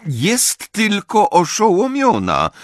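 high, slow, firm correction; impatient. A narrator's reading. Professor bends over the sister to examine her, impatient. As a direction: correct him with weary impatience. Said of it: "powiedziała niecierpliwie".

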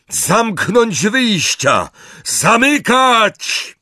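high, drawn-out yell; urgent, slow, high-pitched bellow; shouted order. A narrator's reading. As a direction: shout the command down a big hall. Wizard shouts orders to seal exits.

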